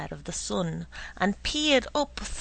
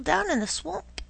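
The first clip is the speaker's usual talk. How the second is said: eager declaration; 9 semitones higher than usual. A narrator's reading. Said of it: "declared Doodle".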